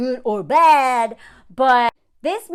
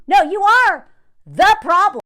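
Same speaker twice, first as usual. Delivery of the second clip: usual speaking voice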